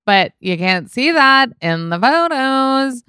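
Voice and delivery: high, loud, drawn-out singsong; playful singsong. Pretend. Telling listeners the photos hide it, playful singsong. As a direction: sing it out teasingly, stretching the last word. Playful aside about dingy set.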